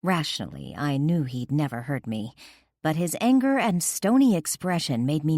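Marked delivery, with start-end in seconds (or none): none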